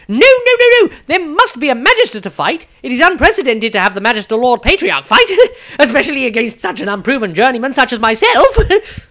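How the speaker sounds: cowardly wizard panicking, high, loud and fast; frightened. Acted. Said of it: "jumped in fright ... hurriedly inquired".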